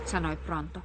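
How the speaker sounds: plain reading voice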